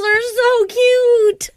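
high, drawn-out squeal of delight; real gushing. Real, not acted. Friend gushing over cute quokka photos, high delighted gush.

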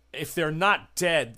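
usual speaking voice

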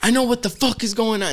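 high-pitched mock mom snapping; imitated angry mom. Mom calling out her son's lie, imitated anger. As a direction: play the mother who isn't fooled. Retold mom not buying it.

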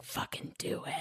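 hushed inner-voice whisper; soft, voiceless egging-on; whispered urging. Acted. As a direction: whisper it like a devilish nudge.

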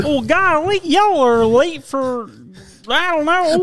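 very high goofy hayseed; Gomer Pyle. Retold or imitated. Friend carries on the sitcom bumpkin impression, high goofy voice. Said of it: "Gomer Pyle".

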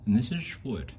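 a hint of a cartoon beaver; beaver impression. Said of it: "That's my beaver impression".

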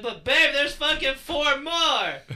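high, loud, slow pleading; excited whine. Retold or imitated. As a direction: stretch it out like a kid begging. Retold pleading with girlfriend.

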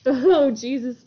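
ordinary delivery

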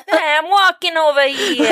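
put-on thick New York accent. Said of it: "the worst New York accent ever".

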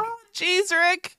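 high-pitched cartoon kid voice; Morty. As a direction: squeak it out as a fretful cartoon sidekick. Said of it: "could you do a Morty?".

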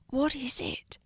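soft, high, whispery question; sleepy hush. A narrator's reading. Jonah just woken by Ella, hushed. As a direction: ask it softly, just woken up.

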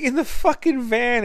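high pitched exasperated imitation; mock exasperation. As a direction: throw it back in disbelief. Friend's exasperated answer.

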